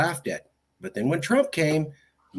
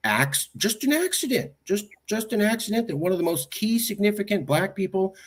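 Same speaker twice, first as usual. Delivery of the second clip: sarcastic; 7 semitones higher than usual. Retold or imitated. Sarcastic mock of official excuse.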